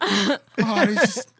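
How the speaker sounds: high, very slow stammer; mock flustered. Man backing down on the phone, high slow stammer. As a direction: stall nervously, drawn out. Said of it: "he was like".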